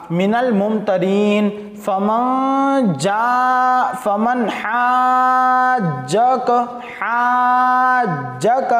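very slow, stretched melodic recitation; slow Quranic chant with long vowels. Shown as a demonstration. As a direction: draw out every syllable like tilawat. Reciting Quran verse in class.

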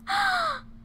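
very high, sharp gasp; shocked gasp. Real, not acted. Player sees his annoyed face, shocked gasp. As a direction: suck in breath high, in amazement.

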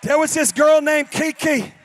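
loud, high, fired-up storytelling; preaching at full force. Acted. Pastor's son roaring the story to his congregation. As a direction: preach it to the back row.

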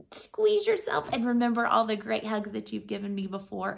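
ordinary delivery

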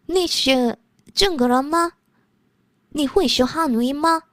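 very slow, deliberate Mandarin; slow careful Mandarin. Real, not acted. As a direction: spell out each Mandarin word slowly. Speaking Mandarin to Chinese viewer.

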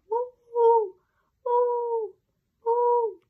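high, loud ghostly woo; howling woo. A narrator's reading. Girl imitating the dog's howl, high ghostly woo.

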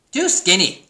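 high, loud pig verdict; blunt pig. A narrator's reading. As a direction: voice a pig's loud, blunt objection. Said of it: "said Pig".

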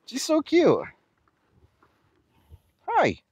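high cooing pet voice. Real, not acted. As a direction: coo at an animal. Cooing to the cow.

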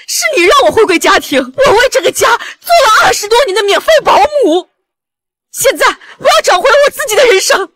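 high-pitched, tearful grievance; impassioned declaration through tears; anguished outburst. Acted. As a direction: cry out long-held resentment. Wife's tearful outburst reclaiming her life.

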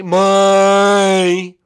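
very loud, high, long cartoon princess shout; obnoxious goodbye. Princess shouting bye over the host, obnoxiously loud. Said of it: "Little obnoxious there".

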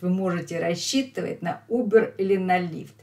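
ordinary talk, nothing marked

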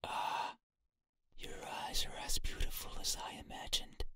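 eerie unvoiced whisper; whispering entity. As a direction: breathe it like a ghost.

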